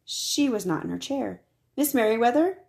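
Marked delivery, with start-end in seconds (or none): 1.8-2.6 higher, louder questioning call; where is she?; puzzled call. A narrator's reading. Searching her empty office.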